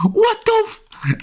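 high, slow, drawn-out outrage; exasperated at the game; stunned outrage. Real, not acted. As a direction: stretch it out in disbelief. Game frustration outburst.

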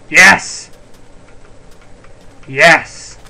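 loud triumphant yes; excited cheer. Real, not acted. Celebrating the village find.

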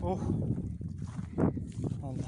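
out of breath, heavy sighs; puffing after effort; gasping for air. Real, not acted. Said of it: "Nefes nefese kaldım". (nefes nefese, derin soluklarla)

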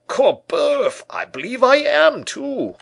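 very high, loud bellow; excited outburst. A narrator's reading. Porthos finds himself among the susceptible people, bellowing excitedly. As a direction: blurt it out in a big put-on voice.

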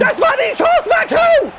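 high shout at a miss; frustrated shout. Real, not acted. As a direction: yell the missed chance. Near miss in the game.

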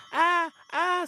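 high-pitched ah ah; high spirit cry. Acted. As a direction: yelp from the stuck spirit. Spirit stuck again.

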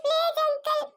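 put-on a small girl begging to be taken to grandma; high, whimpering child voice; lost little girl.

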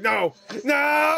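loud, high "No!"; shouting no. Real, not acted. Yelling at the cat on screen, loud no. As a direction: shout it down hard.

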